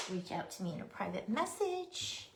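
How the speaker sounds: ordinary talk, nothing marked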